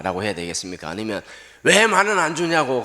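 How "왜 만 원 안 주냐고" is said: loud, pitched-up complaint; indignant yell. Retold or imitated. Preacher voicing an ungrateful complainer, indignant yell. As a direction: bark the grievance at the preacher's audience. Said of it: "고함을 질러야 되겠습니까".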